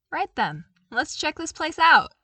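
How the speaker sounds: rally the troopers happily; bright excited invitation; eager Luke. Acted.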